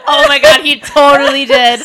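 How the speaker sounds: real loud gleeful disbelief; excited shock. Real, not acted. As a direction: burst out in delighted shock. Shocked reaction to ex story.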